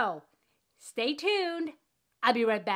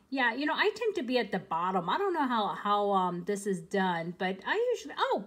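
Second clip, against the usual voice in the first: ordinary talk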